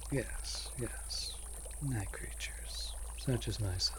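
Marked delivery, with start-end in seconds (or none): 0.1-2.9 whispered, a little higher; slow whispered night creatures; amused whisper. Acted. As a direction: breathe it through leftover laughter. Amused, aside about itself.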